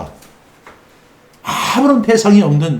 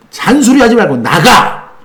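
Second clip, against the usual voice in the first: firm raised order; commanding; 6 semitones higher, 4 dB louder than usual. Retold or imitated. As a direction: order it out, louder. Pastor commanding demon out.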